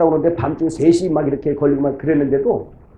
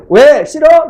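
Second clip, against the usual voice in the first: higher, louder playful asking; mock-surprised, pitched-up why and dislike it; teasing question; 10 semitones higher, 8 dB louder, much slower than usual. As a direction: poke at them with a surprised tease. Pastor teasing the congregation.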